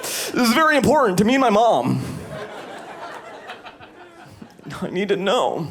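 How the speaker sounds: high insistent pleading voice; hammed-up demanding boyfriend; mock whiny boyfriend. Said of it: "I'm never like".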